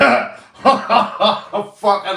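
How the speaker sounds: loud high burst of laughter; big laugh. Real, not acted. Vlogger cracking up at his own line, loud laugh. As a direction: laugh hard at yourself.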